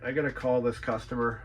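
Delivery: normal talking voice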